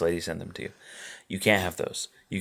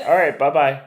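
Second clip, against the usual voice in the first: imitating Isaiah; 6 semitones higher, 6 dB louder than usual. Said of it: "he's like".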